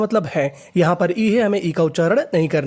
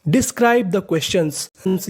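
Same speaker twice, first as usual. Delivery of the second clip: slow reading; much slower than usual. Shown as a demonstration. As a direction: Describe the questions read very slowly, word by word. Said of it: "तो पूरा sentence देखते हैं". (धीमे-धीमे पढ़ना)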